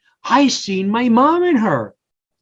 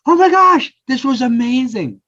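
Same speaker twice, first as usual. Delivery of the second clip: delighted exclamation; 5 semitones higher than usual. Retold or imitated. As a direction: gush over how great it was. Happy instead of angry now.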